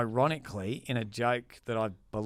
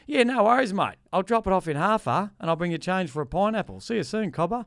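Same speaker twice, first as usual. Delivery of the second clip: higher, louder ocker Australian voice; broad Aussie accent; 7 semitones higher, 4 dB louder than usual. Retold or imitated. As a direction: play the Chinese guy answering in broad Aussie slang. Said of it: "I pretend to be him".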